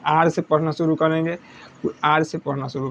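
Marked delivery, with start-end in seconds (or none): none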